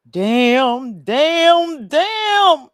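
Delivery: Good Times mother's lament, rising with each damn; Florida Evans. Retold or imitated. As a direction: climb from plain to a loud high cry over three damns. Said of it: "in my Florida Evans voice".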